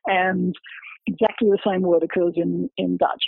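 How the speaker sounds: ordinary talk, nothing marked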